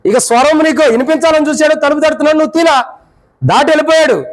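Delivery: pleading sermon at full force; fervent preaching. Acted. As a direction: preach it out with urgency.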